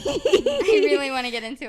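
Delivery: loud, laughing through the words. Real, not acted. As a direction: say it loudly while cracking up. Repeats herself through laughter.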